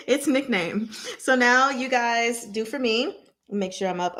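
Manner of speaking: usual speaking voice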